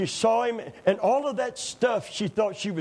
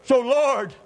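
higher, louder preaching with a shout at the peak; full-force preaching; 5 semitones higher, 4 dB louder than usual. Acted. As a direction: cry the prayer out to the church. Preaches Corrie's prayer at full force.

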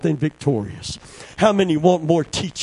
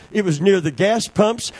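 ordinary talk

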